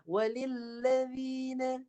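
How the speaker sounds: walilladzina with dh from the side edge of the tongue, slow; slow demo of side-tongue dh in walilladzina. Shown as a demonstration. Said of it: "kita guna hujung tepi lidah".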